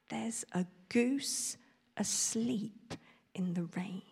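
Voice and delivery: slow, unbelieving whisper; slow puzzled whisper. Acted. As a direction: repeat it slowly in disbelief. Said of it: "repeated Farmer Field slowly".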